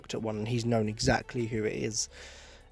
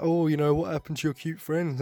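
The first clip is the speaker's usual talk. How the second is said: mock taunt; 5 semitones higher, 4 dB louder than usual. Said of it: "he's like".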